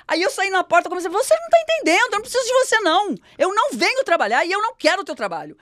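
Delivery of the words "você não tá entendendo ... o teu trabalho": much higher, loud furious retort; shouting at the boss in the doorway; retold shouting back. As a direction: yell your defiance at the boss.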